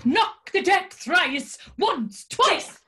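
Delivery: loud, grand fortune teller order; theatrical mystic command. Acted. Tarot reader ordering her client to knock the deck loudly. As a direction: proclaim it like a ritual.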